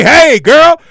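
loud, high catcall; loud eager catcall. Retold or imitated. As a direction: call out to her across the pool. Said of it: "So they".

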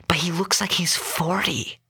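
secretive, incredulous whisper; hushed whisper. A narrator's reading. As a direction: whisper it behind the driver's back. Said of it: "the couple spoke in hushed voices".

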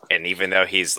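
usual speaking voice

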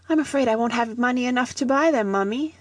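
put-on high, wistful little boy voice. A narrator's reading. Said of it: "said Teddy wistfully".

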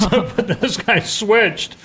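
laughing through the words. Real, not acted. Telling friends the story, laughing as the stranger gives up his seat. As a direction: chuckle it out, amused at him.

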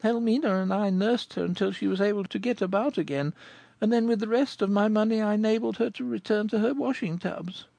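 put-on high, girlish voice telling her sad tale; young girl Goualeuse. Goualeuse tells Rodolphe her story, in a high girlish voice. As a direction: speak as the poor young girl recalling her past.

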